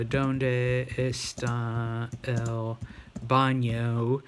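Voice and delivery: very slow Spanish, rising at the end; slow dictation. Shown as a demonstration. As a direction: dictate it slowly for typing. Dictates Spanish question while typing.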